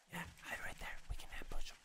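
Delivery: barely audible conspiratorial whisper. Real, not acted. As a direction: whisper the plan from hiding. Hiding from horde, plotting ambush.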